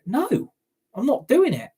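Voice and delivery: high-pitched, indignant no; flatly refuse, up in pitch; defiant refusal. Real, not acted. Fan refusing owner's call for patience.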